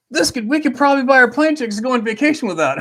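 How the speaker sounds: high, loud excited rush; suddenly thrilled about a free vacation; excited realization. Retold or imitated. Excited about buying tickets.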